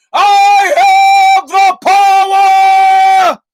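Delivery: huge, high, long-held roar; He-Man battle cry. Acted. Host plays He-Man powered up by Regeneron, full roar. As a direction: bellow it slowly, stretching every word to the limit. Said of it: "like He-Man".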